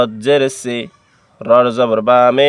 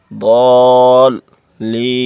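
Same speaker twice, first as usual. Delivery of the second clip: normal talking voice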